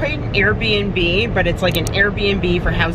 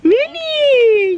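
excited cry; 3 dB louder than usual. Real, not acted. Mom delighted at child's gift.

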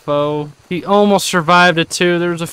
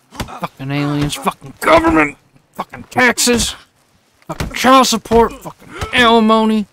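ranting rage; 8 semitones higher than usual. Real, not acted. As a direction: vent every frustration at the screen. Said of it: "Take my anger out on this".

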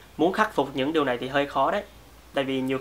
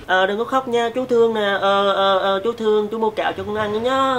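put-on light, higher cooing voice to hush a child; soothing a baby; 5 semitones higher, 3 dB louder than usual. Shown as a demonstration. Adult soothing a baby in arms, gentle coaxing voice. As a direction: coo gently so the baby won't cry. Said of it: "dùng cái giọng gì".

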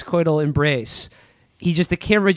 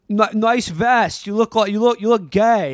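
put-on Boston accent; 5 semitones higher than usual. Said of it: "I hope you guys are enjoying my Boston accent".

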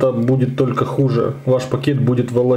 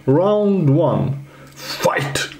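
lightly voiced slow round call, whispered fight; a hint of a Mortal Kombat announcer; fighting game announcer; 4 semitones higher, much slower than usual. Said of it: "Я, конечно, не Эд Бун".

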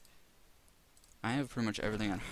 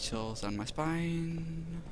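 higher and very slow, stretched out; drawn out dramatic; 6 semitones higher, much slower than usual. Real, not acted. Player spooked by the music, slow drawn-out line. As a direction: savour each word, spooked.

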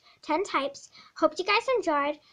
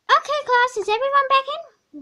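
higher, louder teacher announcement; cheery teacher; 9 semitones higher, 7 dB louder than usual. Acted. Teacher announcing a run to the class, bright and loud. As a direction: call it out to the whole class.

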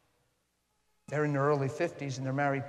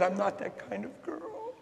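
crying plea; 9 semitones higher than usual. Retold or imitated. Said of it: "she's crying it over and over".

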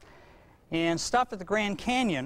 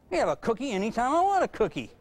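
slightly higher, smug taunt; lightly voiced defiant kid; 6 semitones higher than usual. Hypothetical giant kid taunting his parent, smug. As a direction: gloat like a kid nobody can stop.

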